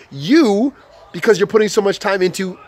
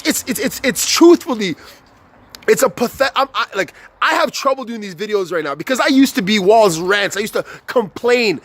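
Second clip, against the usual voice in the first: ordinary talk, nothing marked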